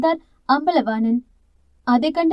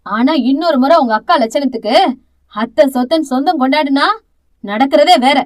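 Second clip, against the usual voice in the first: loud, harsh scolding voice; harsh warning; 5 dB louder than usual. Acted. Aunt warning the younger sister after the slap, harsh and loud. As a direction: threaten her fiercely. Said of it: "கடுமையான குரலில் எச்சரித்தார்".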